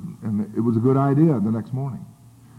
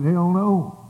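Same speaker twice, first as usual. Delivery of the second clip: a higher, louder hell no; flat-out refuse; emphatic refusal; 8 semitones higher, 4 dB louder than usual. Retold or imitated. Telling the AA caller, an emphatic refusal.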